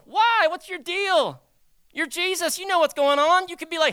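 high, loud, baffled questioning; incredulous challenge. Acted. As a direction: question him in mock disbelief. Mock challenge to Jesus's reaction.

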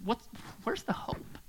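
hushed, breathless whisper. Real, not acted. Preacher whispering to congregation about hope, hushed. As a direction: drop to a whisper.

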